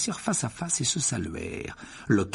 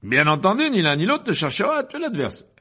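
high, loud, self-satisfied lecturer voice; pompous wizard boasting to the hall; vain showman professor; 12 semitones higher, 7 dB louder than usual. A narrator's reading. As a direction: preen before the students. Lockhart before the duel.

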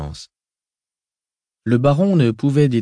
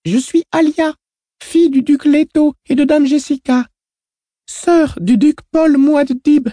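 put-on high, proud girl's voice, slowing; a small girl announcing herself; child voice; 7 dB louder than usual. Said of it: "répondit la fillette".

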